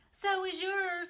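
put-on high, loud child's scream. A narrator's reading. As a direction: shriek it at your brother in glee. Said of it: "screamed Avery".

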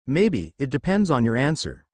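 plain reading voice

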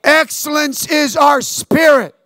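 "Excellence is our spirit" called out loud, slow and high; loud slow declaration. Shown as a demonstration. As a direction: lead the call-and-response. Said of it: "Say it with me".

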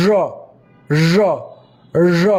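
র said with the tongue folded up; curled r. Shown as a demonstration. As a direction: curl the tongue back for র. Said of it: "জিহ্বাটাকে একটু উপরে". (জিহ্বা fold করে র)

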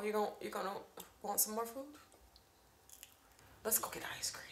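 secretive whispered offer. Pretend. Mom whispering a treat plan to her daughter.

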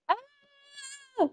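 high drawn-out yelp; startled cry. Real, not acted. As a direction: cry out while spinning. Spinning in reverse.

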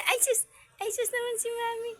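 very high little pet-talk voice; high cutesy cooing. Real, not acted.

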